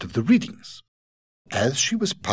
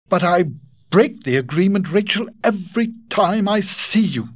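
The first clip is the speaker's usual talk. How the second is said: higher, louder lovesick suitor; pleading Godfrey; 11 semitones higher, 6 dB louder than usual. Acted. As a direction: beg her to understand.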